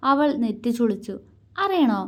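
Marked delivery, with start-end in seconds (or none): 1.6-2.0 higher, teasing little question; a cheeky, sing-song want to know; mischievous tease. A narrator's reading. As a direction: dangle the secret playfully. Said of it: "അവനിൽ കുറുമ്പ്".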